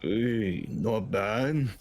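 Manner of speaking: approving battle-weary orc; grudgingly impressed veteran orc voice; put-on orc character; grizzled orc. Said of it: "orc looks down at you".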